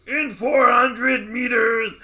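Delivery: slow, high, loud drawn-out nav call; kids' nav shout. Retold or imitated. Kids' recorded nav voice booming at the driver. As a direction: stretch it out and bellow it like a silly GPS. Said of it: "And they'll do, like".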